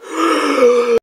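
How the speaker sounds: high, theatrical gasp for breath; dramatic gasp. Acted. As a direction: gasp as if about to reveal a secret. Before reading Miyamoto's message.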